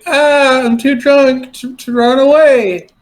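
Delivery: put-on high, slow drunken cry; fake drunk wail. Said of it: "I'm gonna say".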